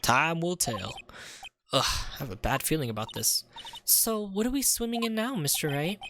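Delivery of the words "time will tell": easygoing Texan cowboy voice; southern cowboy accent. Acted. Cowboy detective reminiscing about bulls, light Texan accent. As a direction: spin it like a folksy Southern lawman. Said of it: "I've never met someone who talks like that".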